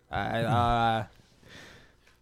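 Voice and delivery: talking with tongue sticking out. Shown as a demonstration. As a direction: say it with your tongue out. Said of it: "talk with your tongue out the whole way".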